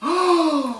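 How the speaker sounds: high, theatrical gasp; mock gasp. Host pretending surprise at the crowd's question, mock gasp. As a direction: gasp in feigned astonishment.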